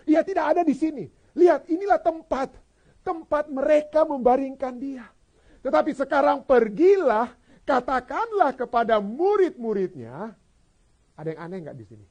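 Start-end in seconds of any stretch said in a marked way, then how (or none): 0.1-10.3 loud, high proclamation; impassioned scripture reading. Acted. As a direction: proclaim the angel's message with force. Reads angel's message at full force. (membacakan pesan malaikat dengan lantang)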